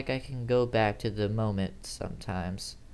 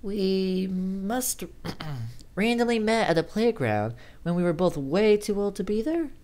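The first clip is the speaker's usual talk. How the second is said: high questioning recall; higher female voice; 8 semitones higher than usual. Acted. Amy recalling their playground meeting, questioning. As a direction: answer as a puzzled friend.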